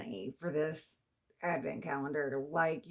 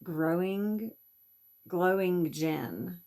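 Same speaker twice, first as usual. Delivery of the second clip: ordinary delivery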